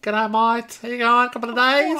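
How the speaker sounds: exaggerated Australian g'day; ocker Australian greeting. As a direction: greet them like a cartoon Australian. Mock-Aussie greeting after Australian-ness teasing.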